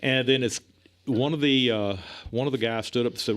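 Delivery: ordinary talk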